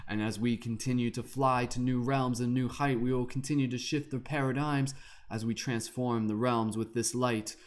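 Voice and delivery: chanted spiritual verse, steady and grand; lilting incantation to the kings and queens; rhymed invocation. Acted. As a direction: recite it like a mystic's poem.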